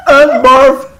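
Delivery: high, loud laugh through a bite; blurting it out between laughs; laughing with mouth full. Real, not acted. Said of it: "And my mouth won't let me chew it".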